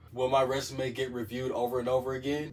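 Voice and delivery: normal talking voice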